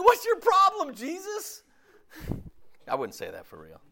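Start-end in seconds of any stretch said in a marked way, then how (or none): 0.0-1.6 much higher, cheeky challenge; mock sassy. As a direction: talk back to Jesus, joking. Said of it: "I mean".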